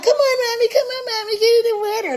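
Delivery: put-on high whining kid voice; imitating kids begging; whiny kids. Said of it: "So they're like".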